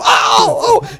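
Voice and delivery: high, loud shrieking oh; mock scream. Flight attendant finds the joint under the newspaper, shrieking. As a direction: shriek in shock, laughing through it.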